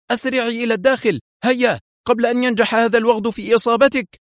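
high, fast yell of alarm; urgent shout. A narrator's reading. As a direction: hurry her inside under fire. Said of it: "وهو يصيح". (صيحة عاجلة عالية يحمي منى من الرصاص)

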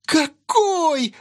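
put-on high girlish squeal of delight. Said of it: "взвизгнула девчонка".

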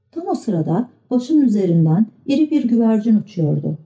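ordinary delivery

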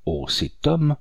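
understated storybook horror; horrified cry. A narrator's reading. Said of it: "avec épouvante".

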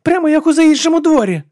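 loud, high-pitched yell; shouting. A narrator's reading. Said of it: "закричала Алекс".

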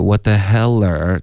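"what the hell" stretched out slowly; slowed-down demonstration. Shown as a demonstration. Teacher untangles a student's tongue, slow "what the hell". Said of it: "这慢一点来".

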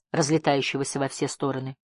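plain reading voice

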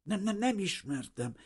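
high, stuttering insistence; stubborn, scared denial. A narrator's reading. Refugee denying the friendship as teeth chatter, stubbornly afraid. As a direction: keep denying it in a high, nervous voice. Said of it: "hajtogatta Vrana Bazil makacsul".

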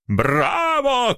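high cheering cry; crowd shout. Acted. Local writers cheer the poet, high cry. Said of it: "кричали местные литераторы".

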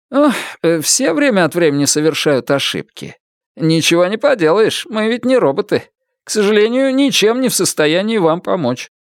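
high-pitched, excusing estate agent; hand-wringing official, pitched high; evasive agent. A narrator's reading. Agent brushes off a complaint.

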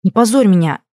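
forceful warning to behave; shushing rebuke. A narrator's reading. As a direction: reprimand sharply, mortified. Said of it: "шикнула я на фамильяра".

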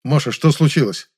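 usual speaking voice

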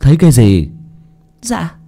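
high, stuttering frightened servant voice; terrified stammering maid. Acted. Maid Mơ stammers to her masters in high frightened voice. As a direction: stammer it out, shaking with fear.

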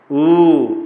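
"ऊ" को दो मात्रा तक खींचकर, ज़ोर से; long vowel demo. Shown as a demonstration. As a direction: hold the ऊ long and loud. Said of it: "इसके सदृश यदि उच्चारण होगा". (दीर्घ स्वर का प्रदर्शन)

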